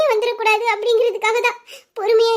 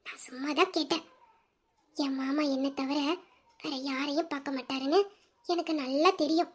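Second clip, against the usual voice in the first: tender devotion; 8 semitones lower, 8 dB quieter than usual. Acted. Wife's tender reply of devotion.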